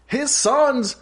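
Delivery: personal gossip shouted as a play call; high, loud quarterback bellow in separate bursts; Peyton Manning. Retold or imitated. Quarterback exposing an opponent on the field, mock shouted call. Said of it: "he really was like".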